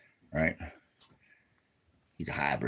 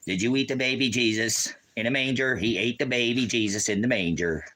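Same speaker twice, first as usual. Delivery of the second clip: high-pitched mock accusation; mock outrage; 6 semitones higher, 4 dB louder than usual. Asking his dingo about baby Jesus, mock outrage. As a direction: accuse the dog in pretend horror.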